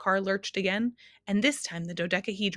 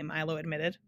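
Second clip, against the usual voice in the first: ordinary delivery, nothing marked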